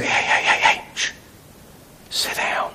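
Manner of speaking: whispered hushing, as if silencing someone; shushing whisper. Pretend. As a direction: hiss them to sit down in a whisper. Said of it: "I will not be shushed".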